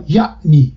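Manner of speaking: یعنی with a glottal stop after ya; pronounced ain. Shown as a demonstration. Linguist showing what Persians don't say, pronounced glottal stop. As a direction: break یعنی sharply as ya'-ni.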